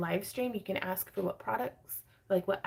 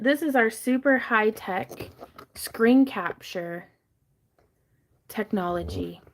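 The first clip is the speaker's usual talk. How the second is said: slow mock-grand sarcasm; sarcastic; 6 dB louder, slower than usual. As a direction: drag it out ironically. Jokes about low-tech setup.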